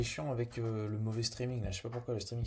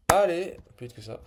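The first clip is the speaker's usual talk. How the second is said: impatient; 6 semitones higher, 11 dB louder than usual. Real, not acted. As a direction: hurry it up, out loud. Urging slow program on.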